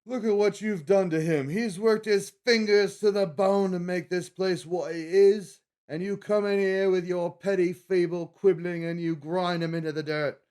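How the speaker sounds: high, loud mock-British tirade; bad British accent. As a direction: bellow it like an outraged British cook defending his chef. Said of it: "that was actually pretty bad, but he does a real good British accent".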